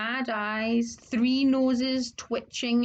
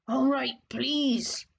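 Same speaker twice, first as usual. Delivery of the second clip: slow reluctant whisper; grudging whisper; 2 semitones higher, slower than usual. Acted. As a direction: force out the word under breath. Filch grudgingly whispers please.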